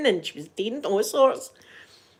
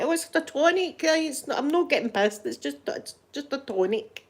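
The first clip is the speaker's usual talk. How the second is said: higher-pitched mock excuse; mock protest; 7 semitones higher, 3 dB quieter than usual. Mimics wine drinkers excusing themselves.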